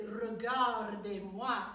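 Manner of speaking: "Regardez-moi" said slowly with pursed, kissing lips; slow "Regardez-moi" through lips lifted and pursed; kissing position. Shown as a demonstration. Teacher demonstrating "Regardez-moi" slowly with kissing lips. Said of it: "sort of kissing position".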